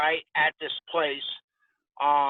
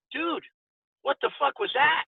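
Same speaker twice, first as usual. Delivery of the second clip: much higher, incredulous gasp of a question; stunned disbelief; 10 semitones higher than usual. Retold or imitated. Young boxer turning to his stablemate after the beating, stunned. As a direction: retell the shock, voice jumping up. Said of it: "and I said".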